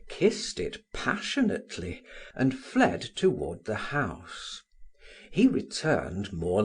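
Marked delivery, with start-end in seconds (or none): none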